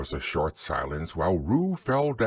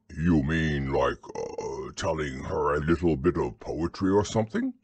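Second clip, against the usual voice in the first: low, ponderous bear voice; dim, gentle bear thinking aloud; Pooh; 6 semitones lower than usual. Acted. Pooh's voice in story reading.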